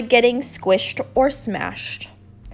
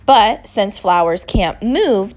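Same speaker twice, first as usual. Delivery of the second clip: ordinary delivery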